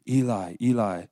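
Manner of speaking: restrained cry to God; loud cry. Retold or imitated. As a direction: call it out loudly, as scripture quoted. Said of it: "cried out in a loud voice".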